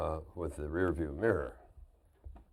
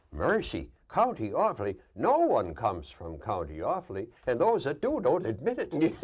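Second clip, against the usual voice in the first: emphatic Irish brogue, rising loud and high; mock-appalled Irish driver, building to the punchline; Irish bus driver; 7 semitones higher, 6 dB louder than usual. Driver scoffing at County Offaly, incredulous Irish accent. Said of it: "John, the bus driver".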